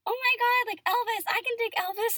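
hammed-up fangirl gush; gushing fan. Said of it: "everyone's like".

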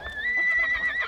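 a short amused chuckle. Acted. Bartender chuckling at young ranch hand. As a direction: laugh warmly at the cowboy's eagerness. Bartender laughs before answering.

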